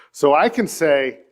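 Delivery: ordinary delivery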